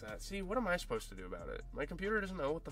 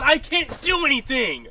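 higher, louder raging yell of helplessness; furious shout; 12 semitones higher, 15 dB louder than usual. Real, not acted. Gamer yelling that his team does nothing, furious shout. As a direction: bellow it in despair at the screen. Repeat of line 4, now yelled.

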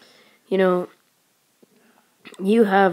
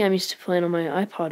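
ordinary talk

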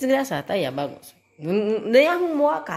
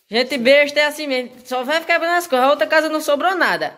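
ordinary talk, nothing marked

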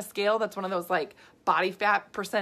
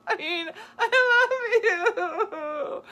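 slow, higher, laughing through the words; laughing love you; 12 semitones higher, 5 dB louder, much slower than usual. Real, not acted. Coach laughing fondly about her husband, slow and high. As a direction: laugh it out with real affection.

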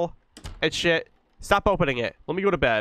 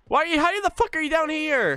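shocked disbelief; 12 semitones higher than usual. Real, not acted. Baby appears downstairs.

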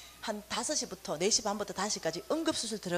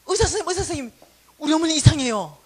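higher and louder urgent call; much louder urgent cry; calling out for doctor; 4 semitones higher, 11 dB louder, faster than usual. Retold or imitated.

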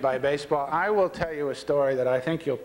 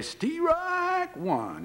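long, high drawn-out call; umpire's strike call. Retold or imitated. Rookie umpire calling the first pitch, high drawn-out strike call. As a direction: sing it out like an umpire, stretching STRIKE.

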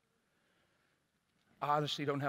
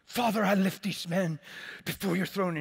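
louder, higher, booming prayer voice; intercession voice, hammed up; 6 semitones higher, 9 dB louder than usual. Pastor replays his loud prayer to God, intercession voice. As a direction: pray it grandly, like a showy intercessor. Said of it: "put on my intercession voice".

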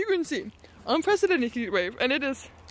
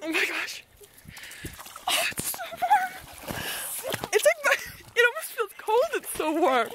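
squealing surprise; 9 semitones higher than usual. Real, not acted. Stepping into hot water.

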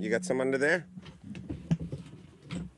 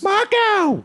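high, loud yelled Marco; calling out; 7 dB louder than usual. Acted. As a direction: yell as loud as you can. Calling out again.

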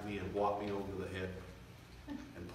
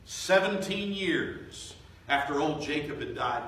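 preaching build; 6 semitones higher, 7 dB louder, slower than usual. Acted.

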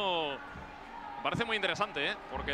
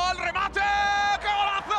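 rising to a high goal shout; breakneck goal commentary; 13 semitones higher, slower than usual. Real, not acted. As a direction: explode on the goal. Live goal call.

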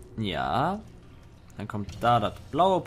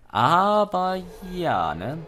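mock robotic voice; 6 semitones higher, 4 dB louder, much slower than usual. Let's Player mimics GLaDOS tone.

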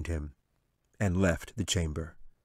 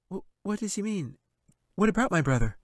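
put-on high, stammering girl's voice; a scared child asking; frightened girl; 12 semitones higher, 4 dB louder than usual. Katie asking the angel, high and frightened.

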